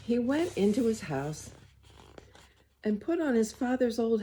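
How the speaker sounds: put-on gentle read-aloud to children; story reading. A narrator's reading. Reader to the children, storytime voice. Reads picture book aloud.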